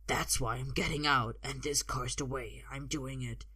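low character voice of a threatening man; menacing fighter. A narrator's reading. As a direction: speak low to intimidate a kid. Escaped fighter voiced in a story.

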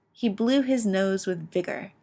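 ordinary delivery

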